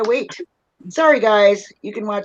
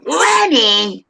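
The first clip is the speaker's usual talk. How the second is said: higher, drawn-out call in Bronx character; mock nagging call; insistent call; 3 semitones higher, 4 dB louder than usual. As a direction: holler the name and stretch it out. Calls Lenny again, insisting.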